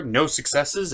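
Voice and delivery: usual speaking voice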